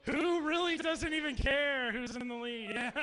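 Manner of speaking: ordinary delivery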